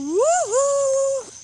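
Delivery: put-on high, loud childish bawl; exaggerated crying howl; mock wail. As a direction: let out a long hammed-up wail. Mock bawling as the punished child.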